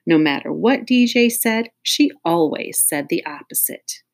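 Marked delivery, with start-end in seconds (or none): none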